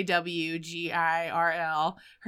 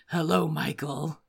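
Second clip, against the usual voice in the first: low, sinister tape-recording voice; amateur go at a deep gravelly killer voice; Tobin Bell as Jigsaw; 4 semitones lower, 4 dB quieter than usual. Acted. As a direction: attempt a deep villain's taped greeting. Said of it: "I wish I could do Tobin Bell's voice".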